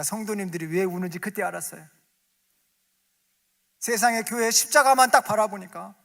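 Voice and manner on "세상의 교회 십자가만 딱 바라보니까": loud, high exhortation building to a peak; full-force preaching. Acted. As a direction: drive the call home like a fiery preacher. Preaching climax. (크고 높게 외치는 설교)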